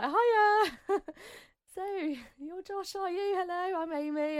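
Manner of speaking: high, sing-song nervous greeting; awkward chirpy hello. Retold or imitated. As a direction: act bright to cover the awkwardness.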